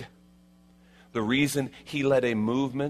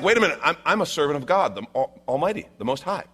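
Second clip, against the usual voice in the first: higher, louder protesting Saul; 6 semitones higher, 4 dB louder, faster than usual. Acted. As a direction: insist on your own standing. Saul pleading in story.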